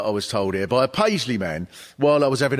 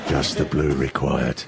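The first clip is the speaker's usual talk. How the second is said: much lower, hushed TV commentator; snooker commentator; 12 semitones lower than usual. Pretend. Commentator calls the World Snooker Final in a low hushed voice.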